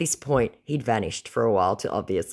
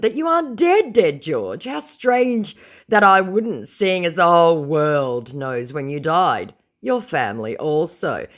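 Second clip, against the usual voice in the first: slow, louder, sneering read of her reply; mocking taunt; 6 semitones higher, 6 dB louder, slower than usual. Reading her reply to the scammer in a mocking taunt. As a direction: taunt him like a fool.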